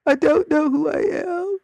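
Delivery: high hammed-up wail; mock crying. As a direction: imitate a sobbing twelve-year-old in crisis. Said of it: "went in and just cried".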